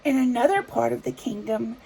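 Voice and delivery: plain reading voice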